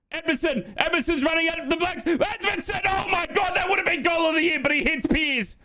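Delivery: high-pitched, frantic football commentary yell; excited commentary. Real, not acted. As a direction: erupt as the striker nearly scores a wonder goal. Live commentary, big chance.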